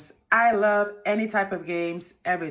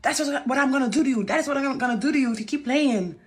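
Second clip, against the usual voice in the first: threatening date; 6 semitones higher than usual. Retold or imitated. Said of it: "He was like".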